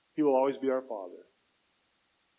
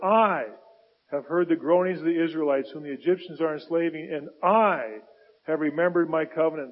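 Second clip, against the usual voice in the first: God's voice, proclaimed firmly and loud; scripture reading as God; 6 dB louder than usual. Acted. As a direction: declare each I AM like the Lord Himself. Reads God's words in Exodus.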